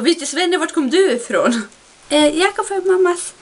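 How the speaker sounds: high, silly goofy voice; lightly hammed-up goofy banter; mock dim-witted exchange. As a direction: play it dumb and nasal-free. Mocking dumb dialogue.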